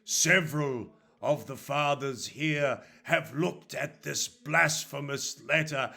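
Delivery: slow, loud, weighty accusation; stern accusing priest. Acted. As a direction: pronounce it like a judge in church. Father Coleman accuses Stevenson.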